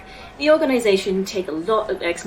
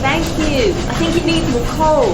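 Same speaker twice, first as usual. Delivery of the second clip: higher, louder, proud over the din; shouting over noise; 9 semitones higher, 9 dB louder than usual. Acted. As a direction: shout it over the engine racket. Shouting in the hot engine room.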